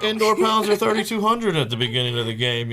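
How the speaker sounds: high, loud excited rush. Real, not acted. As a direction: blurt it out, thrilled. Excited numbers rant.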